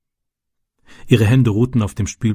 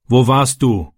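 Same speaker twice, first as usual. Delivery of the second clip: irritated; slower than usual. A narrator's reading. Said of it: "fragte sein Vater gereizt".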